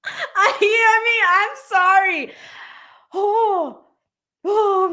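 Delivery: very high, squeaky from laughing; overcome with laughter. Real, not acted. Streamer saying sorry to viewers, overcome with laughter. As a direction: squeeze out an apology mid-fit. Apologizing amid a laughing fit.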